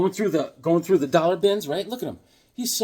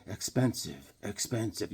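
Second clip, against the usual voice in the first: low muttered expensive; 8 semitones lower, 12 dB quieter than usual. Retold or imitated. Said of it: "Swag is like".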